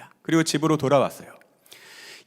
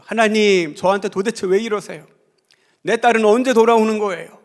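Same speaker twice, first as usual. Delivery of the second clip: much higher, louder anguished cry at God; pouring out resentment; 8 semitones higher, 9 dB louder than usual. Retold or imitated. Mother at Friday night prayer crying out to God, loud and anguished. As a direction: wail it out like a desperate mother. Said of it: "막 원망을 쏟아냈죠".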